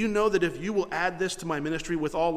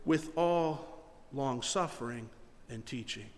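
slow, soft, drawn-out quote; slow reverent reading; 4 semitones lower, 5 dB quieter, slower than usual. Shown as a demonstration. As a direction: lay each word down gently. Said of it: "we forget these last few words".

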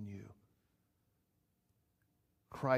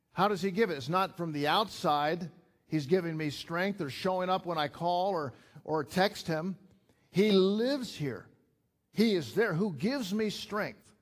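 fiery pulpit preaching; full-force preaching; 5 semitones higher, 5 dB louder than usual. Acted. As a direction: preach it out, building to a peak.

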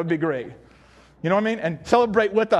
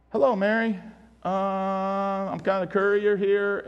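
bored courier; 2 semitones lower, 3 dB quieter, slower than usual. Acted. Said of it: "'cause this is not some".

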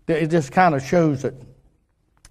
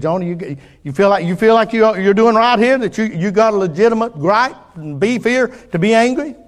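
God's rising challenge; 7 semitones higher, 5 dB louder than usual. Acted. Voicing God questioning Jonah.

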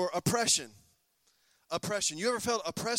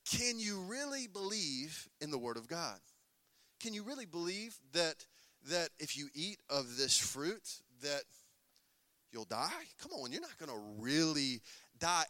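soft, coaxing serpent voice; sly hushed tempter voice; tempting whisper; 3 semitones lower, 7 dB quieter than usual. Retold or imitated. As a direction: lean in and whisper the doubt softly. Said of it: "He began to whisper to them".

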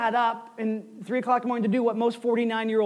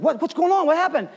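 higher, startled mock-waking voice; startled awake; 6 semitones higher, faster than usual. Pastor acts brother-in-law woken in the tent, startled. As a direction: blurt it like someone jolted from sleep. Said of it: "woke him wide up".

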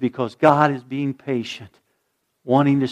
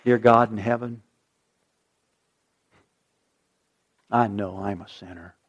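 soft, slow prayer with long pauses; slow hushed prayer; 4 semitones lower, 7 dB quieter than usual. Acted. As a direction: lead the room gently in prayer. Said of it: "I'm gonna say the words".

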